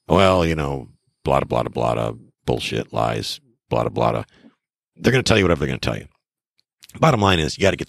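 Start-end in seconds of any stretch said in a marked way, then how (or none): 0.1-4.2 low, droning mock answer; mock rambling reply; dismissive mimicry. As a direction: reel off the seller's excuses in a low monotone. Mimics seller's evasive, meaningless answer.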